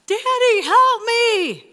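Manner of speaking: high, loud cry for Daddy; frightened child's yell. Retold or imitated. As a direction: act out a scared little girl calling for help. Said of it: "she yells, uh, she cries out".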